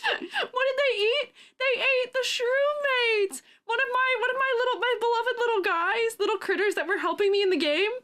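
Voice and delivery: high-pitched, worked-up lament; high, theatrical upset over beloved critters; comic distress. Real, not acted. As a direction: wail the grievance in a high voice. Re-lives upset over eaten shroom mates.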